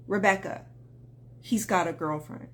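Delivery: low, soft, deflated delivery; heartbroken, retold. Woman messaging her coach about a girlfriend, imitated heartbreak. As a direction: let the voice sink as if crushed.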